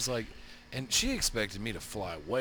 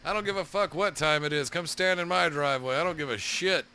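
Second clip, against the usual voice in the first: loud, raised-pitch retold holler; defiant yelling; 5 semitones higher, 5 dB louder than usual. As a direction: holler back a challenge to the neighbor. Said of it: "you just hear me go".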